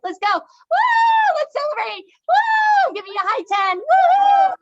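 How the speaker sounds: high, loud whooping cheers; fan cheering. Shown as a demonstration. Teacher leads class cheering their favorite team. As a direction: celebrate like your team just won. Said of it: "Let's do our favorite team".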